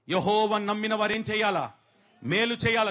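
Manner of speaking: loud, ringing call to the church; fervent preaching. Real, not acted. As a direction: press the congregation to answer. Preacher calling congregation response. (బిగ్గరగా ప్రసంగం)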